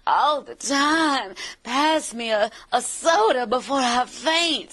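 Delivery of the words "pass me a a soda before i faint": high, drawn-out film noir Southern belle; breathy Southern bombshell. Shown as a demonstration. Voice actor recreating her commercial bombshell, breathy Southern drawl. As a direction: breathe it out like a swooning Southern siren. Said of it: "she talked all breathy like Jessica Rabbit".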